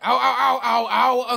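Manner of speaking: hammed-up ow ow ow; loud yelping ows; loud mock pain cries. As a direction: yelp like you're hit.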